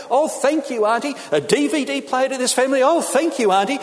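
put-on high-pitched, gushing children's gratitude; gushing thanks. Cousins thanking their auntie for a DVD player, gushing. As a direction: lift it high like delighted children thanking an aunt.

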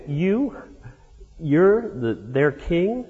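high, mildly mocking disbelief; a hint of a scoffing governor; half joking. Pilate half-joking at the battered prisoner, lightly mocking. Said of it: "and he half joking".

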